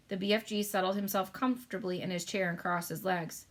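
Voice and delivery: ordinary delivery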